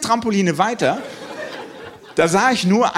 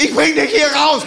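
much higher, louder heroic war-movie cry; war-mode soldier; 9 semitones higher, 4 dB louder than usual. Retold or imitated. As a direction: bark it like a soldier rescuing a comrade. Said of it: "in so einen Kriegsmodus rein".

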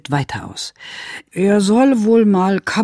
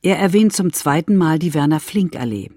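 ordinary delivery

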